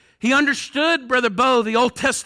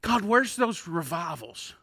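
hushed, lower plea to God; prayerful; 6 semitones lower, 7 dB quieter than usual. Retold or imitated. Preacher recalling his own prayers to God, softly prayerful. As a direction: drop low and soft, as in private prayer. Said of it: "that I've prayed".

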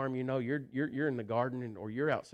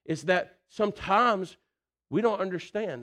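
preaching loud; 8 semitones higher, 7 dB louder than usual. Acted. Preacher's emotional peak.

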